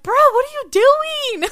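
high, loud disbelieving call; incredulous. Retold or imitated. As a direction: yell at the screen in disbelief. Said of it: "I was like".

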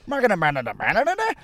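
gibberish Irish regional accent climbing very high. Shown as a demonstration. Comic running through Irish regions, high gibberish accent. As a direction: babble a caricature Irish accent, rising sharply. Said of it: "I pretty much would go east".

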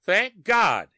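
high, loud burst of relief; gasped relief. A narrator's reading. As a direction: gasp it out, flooded with relief. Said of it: "he gasped".

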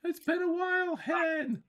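very high Scottish greeting; hammed-up high Scottish voice; Scottish accent. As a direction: exaggerate a Scottish accent, pitched right up. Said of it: "in a Scotch accent".

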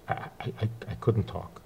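choked-up stammer, breath failing on the I's. Retold or imitated. Recalling meeting the Rebbe, too choked up to speak. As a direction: voice catching, words barely coming out. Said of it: "I got so choked up".

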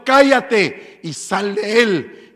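very slow, loud command; stern rebuke. A narrator's reading. Jesus commanding the demon out, loud and slow. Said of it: "lo reprendió".

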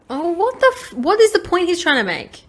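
loud high disbelief; exasperated, half shouting; outraged. Real, not acted. Outraged at interviewer.

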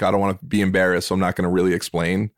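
quick, low voice of a hidden thought; quick inner voice. Retold or imitated.

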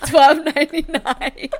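slow, loud, high laughing price callout. Real, not acted. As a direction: drag it out, laughing like an ad. Joking about price, laughing.

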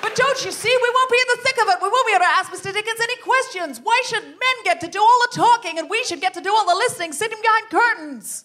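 high, loud, indignant rallying cry; rousing ringleader. Acted. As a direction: stir up the club against the press men. Improv as Jenny June.

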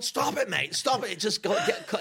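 high-pitched pleading to stop; flustered protest. Retold or imitated. Fending off Brendan in story.

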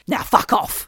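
gruff shouted curse from the ghost voice; gruff entity curse. Retold or imitated. Imitating the entity telling them off, gruff shout. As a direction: spit it out like a rude old spirit. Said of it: "he says".

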